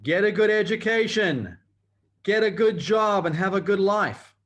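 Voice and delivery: loud, high, mocking recital of the lie; mock parroting. Speaker mocking the lie everyone is told, loud and high. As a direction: parrot the old promise with loud scorn. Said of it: "And the lie is".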